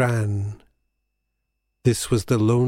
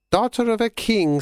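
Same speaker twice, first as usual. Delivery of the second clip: put-on high, cracked old crone; 10 semitones higher than usual. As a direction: croak it like a sea hag. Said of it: "said the crone".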